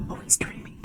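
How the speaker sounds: soft, tender whisper. Real, not acted. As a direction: breathe it out to avoid waking him. Whispering about sleeping pet.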